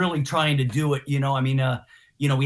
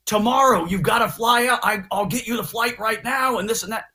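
retold urgent bandmate; 6 semitones higher than usual. Guitarist on the phone begging a fill-in, raised urgent voice. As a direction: press him to fly out tomorrow. Guitarist urging him to fly.

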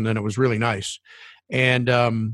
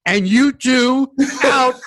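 higher, louder, slow mock barked order while laughing; gruff cab driver; 12 semitones higher, 6 dB louder, slower than usual. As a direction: ham up the burly driver kicking them out. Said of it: "he turns around ... and he says".

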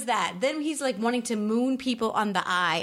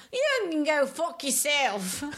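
put-on Lawrence's accent; 5 semitones higher, slower than usual. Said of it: "do that accent again".

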